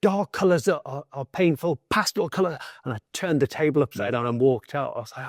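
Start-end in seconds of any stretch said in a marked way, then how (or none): 0.0-2.6 higher-pitched, indignant retort; enraged. Retold or imitated. Patient snapping at therapist over colours for pain, enraged. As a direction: throw the question back in mock rage. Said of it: "it was this enraged moment".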